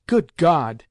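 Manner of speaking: loud, distressed exclamation; exclaimed. A narrator's reading. Grandmother appalled at her weeping grandson, loud exclamation. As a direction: cry out in dismay, loud. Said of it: "exclaimed Grandmamma".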